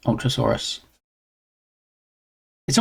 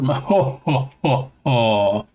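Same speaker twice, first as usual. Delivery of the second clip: hearty ho ho ho; Santa laugh; much slower than usual. Shown as a demonstration. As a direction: try a jolly Santa laugh. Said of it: "I'm just working on the laugh".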